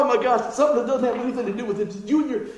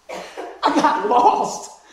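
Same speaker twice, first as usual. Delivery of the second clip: slow, laughing reveal; chuckling confession; 2 semitones higher, 6 dB louder, much slower than usual. Real, not acted. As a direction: drag it out through a laugh. Dramatic reveal of getting lost.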